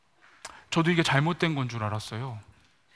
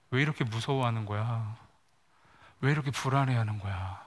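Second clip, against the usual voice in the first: much softer, lower, tender questions; gentle self-questioning; 5 semitones lower, 6 dB quieter than usual. Acted. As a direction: ask your hurt self kindly. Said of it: "저에게 이렇게 질문합니다".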